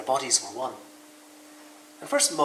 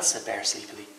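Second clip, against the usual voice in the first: plain reading voice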